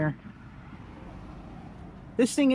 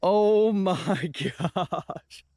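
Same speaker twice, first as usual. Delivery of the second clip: amazed laughing; 2 semitones lower, much slower than usual. Real, not acted. Big reveal of the pumpkin.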